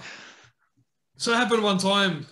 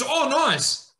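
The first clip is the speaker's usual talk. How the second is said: higher, keen mock Goose; a touch of a hammed-up eager friend; 8 semitones higher, 3 dB louder than usual. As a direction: chirp it like the overeager mate. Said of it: "Goose goes".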